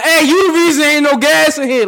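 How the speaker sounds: yelling at the man hoarding gas cans; retold yell. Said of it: "I started yelling".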